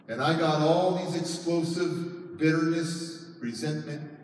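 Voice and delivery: ordinary delivery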